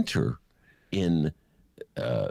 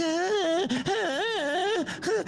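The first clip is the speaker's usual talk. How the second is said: high, shrill-free squealed ahs; imitating Shelley Duvall's cries; mock scream; much slower than usual. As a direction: squeal like a terrified heroine. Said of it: "Shelley Duvall's like".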